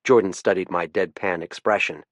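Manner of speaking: plain reading voice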